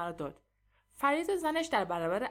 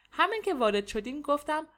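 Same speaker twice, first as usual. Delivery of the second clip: ordinary delivery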